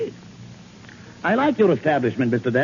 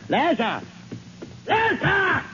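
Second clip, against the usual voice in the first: calling a servant from a distance, higher; slower than usual. Acted. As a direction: call down the stairs for him.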